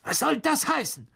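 high, irritated demand; angry villain's sharp question; snarling. A narrator's reading. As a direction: snap it in outrage. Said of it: "fauchte Mister Malfoy".

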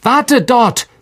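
high call through the rocks. A narrator's reading. Harry calling to Ron past the rubble: firm call. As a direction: call firmly to a friend. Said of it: "rief er Ron zu".

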